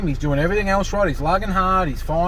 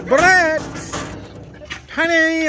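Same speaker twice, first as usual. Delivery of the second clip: calling the dog; 13 semitones higher, much slower than usual. Real, not acted. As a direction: yell for the dog across the paddock.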